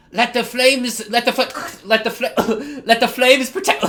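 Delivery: wysoki, głośniejszy, teatralny czar, przerywany śmiechem; mock wizard incantation. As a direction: zaklinaj ogień jak przejęty mag, który nie może skończyć. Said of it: "it'd be like that".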